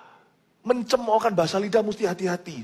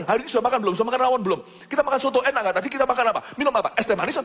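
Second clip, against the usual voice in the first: fast Indonesian chatter; 6 semitones higher, much faster than usual. Shown as a demonstration. Preacher shows foreigners how fast Indonesians talk, rattled off. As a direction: rattle it off like a fast local speaker. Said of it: "kalau kita ngomongnya seperti cepet ini".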